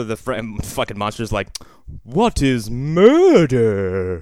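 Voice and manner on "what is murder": very slow, louder puzzled monster; hammed-up monster voice; mock monster. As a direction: drag it out, baffled creature. Monster voiced in retold scene.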